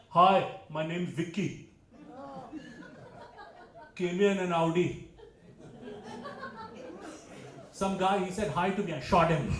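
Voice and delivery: a boastful Delhi guy showing off; proud Punjabi guy. Retold or imitated. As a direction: brag it like a proud tough guy. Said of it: "Punjabis can say things proudly".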